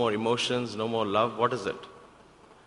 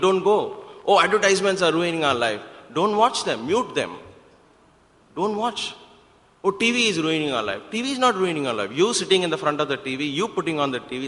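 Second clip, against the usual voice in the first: high, raised exhorting voice; emphatic preaching; 5 semitones higher, 5 dB louder than usual. Real, not acted. Preacher telling listeners to stop blaming others, emphatic preaching. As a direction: drive it home to the congregation, insistent and punchy.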